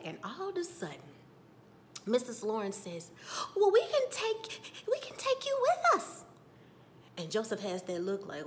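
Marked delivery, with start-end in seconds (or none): 3.6-6.2 mock eager squeal, far above usual voice; high-pitched offer. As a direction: pipe up very high and loud, imitating her. Acting out Lawrence's eager offer.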